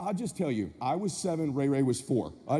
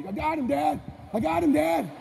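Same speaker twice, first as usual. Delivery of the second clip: put-on excited kid; 6 semitones higher than usual. Kid calling to dad mid-fight.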